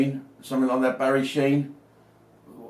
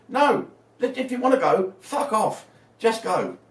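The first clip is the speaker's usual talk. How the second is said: exasperated rant; 7 semitones higher than usual. Real, not acted. Angry rant at Scotland and Wales.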